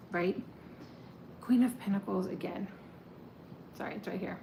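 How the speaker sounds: ordinary talk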